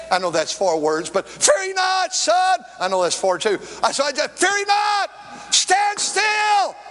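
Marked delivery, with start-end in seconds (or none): 1.3-2.6 exhorting shout. Acted. Preacher calling out self-exhortation. 4.3-6.8 preaching shout. Acted. Preacher crying out the verse.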